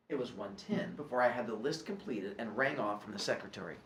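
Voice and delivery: ordinary delivery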